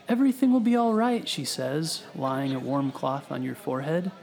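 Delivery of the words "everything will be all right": lightly voiced kind nurse, higher, quicker. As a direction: reassure a patient as a kind nurse. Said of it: "you hear a kind voice".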